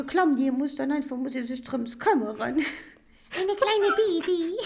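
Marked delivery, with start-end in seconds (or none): 2.4-4.6 put-on high, slow, cooing baby talk; giggly baby talk; cute baby voice. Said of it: "Was ist das für ein Stimme".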